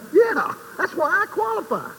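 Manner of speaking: high, laughing repeat of the punchline. Real, not acted. Preacher laughing about his own sins, high. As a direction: chuckle it out.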